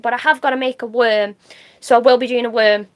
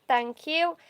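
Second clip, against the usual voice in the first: normal talking voice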